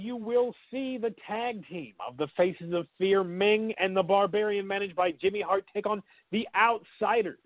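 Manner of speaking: high, hyped ring-announcer call. Pretend. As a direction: sell the matchup like a ring announcer. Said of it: "Put the voice on".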